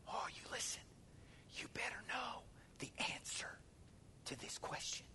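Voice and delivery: urgent whisper; intense whisper. Acted. Pastor warning the church intently, whispered.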